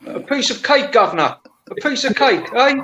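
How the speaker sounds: high Geordie "A piece of cake"; Geordie accent. Retold or imitated. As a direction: put on a Geordie twang. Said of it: "is that Geordie accent".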